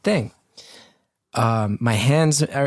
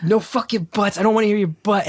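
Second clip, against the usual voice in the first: higher, heated mock fury; angry confrontation; 8 semitones higher than usual. Telling off the instructor who won't apologize, higher and heated. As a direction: jab each no buts at him.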